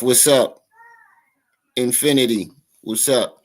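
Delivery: usual speaking voice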